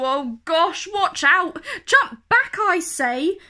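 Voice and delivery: oh gosh, rising to a high loud jump back; mock panic building to a cry; alarmed warning. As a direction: sound the alarm, then shout to leap away.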